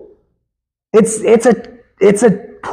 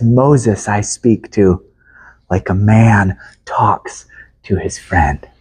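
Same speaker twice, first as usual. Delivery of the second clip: much lower, slow, hushed and tender; tender voice of God; 10 semitones lower, slower than usual. Acted. God telling of talking with Moses as a friend, slow hushed tender voice. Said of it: "You hear the tenderness in the Lord's voice".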